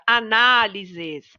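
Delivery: análises said slowly, NA drawn out; stress on NA. Shown as a demonstration. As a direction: hold the NA in análises for the class. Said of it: "a sílaba que a gente lê com mais força".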